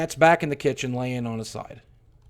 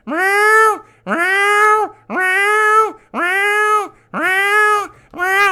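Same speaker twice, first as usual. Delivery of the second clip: high, thin kitten meows, much higher and louder; cat meowing; 6 dB louder, much slower than usual. Retold or imitated. Narrator imitating the buried cat's meows, high and loud. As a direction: mew like a weak dying kitten. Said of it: "I hear".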